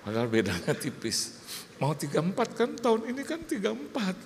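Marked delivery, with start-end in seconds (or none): 1.8-4.1 high-pitched mock insistence; insistent correction. Wife correcting the count of married years, insistent and high. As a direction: imitate a wife pressing the point, pitch climbing. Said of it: "Dia tambahin lagi".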